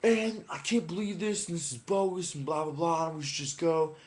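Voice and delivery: high-pitched mock griping; imitated complaint.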